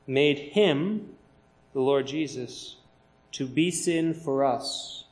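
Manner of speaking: slow, weighty verse reading with pauses; slow scripture reading. Acted. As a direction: lay out each phrase of the verse. Reads verse twenty-one slowly.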